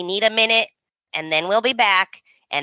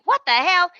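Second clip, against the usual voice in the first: mock outrage; 9 semitones higher than usual. Paris reacts, retold.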